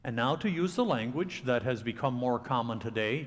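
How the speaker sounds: normal talking voice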